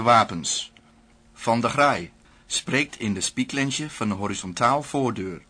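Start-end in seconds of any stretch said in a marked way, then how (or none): none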